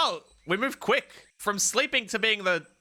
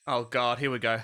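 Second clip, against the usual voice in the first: ordinary talk, nothing marked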